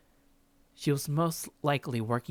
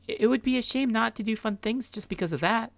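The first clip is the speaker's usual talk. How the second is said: put-on higher, bright friendly young woman; Grace's voice; 10 semitones higher, 4 dB louder than usual. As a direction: play Grace as cheerful and curious.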